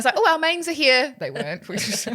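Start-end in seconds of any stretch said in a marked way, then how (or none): 0.2-1.1 high bright fake excuse; lightly hammed-up escape line. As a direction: chirp a made-up excuse to leave.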